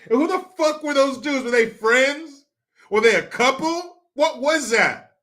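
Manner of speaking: can't believe what you saw; loud mocking disbelief; incredulous. Real, not acted.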